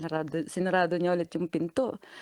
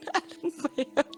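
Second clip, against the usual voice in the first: normal talking voice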